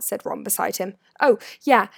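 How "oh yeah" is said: quick reply. A narrator's reading. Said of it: "said Harry quickly".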